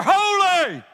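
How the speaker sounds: high, loud shout of awe; crying out. Acted. One angel crying to another, awed shout. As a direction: shout it high and let it ring. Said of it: "one cries the other".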